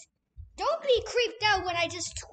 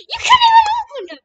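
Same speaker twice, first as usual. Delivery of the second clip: high fast laughing yell; laughing shout; 14 semitones higher, 13 dB louder, much faster than usual. Real, not acted. Frustrated with lockers.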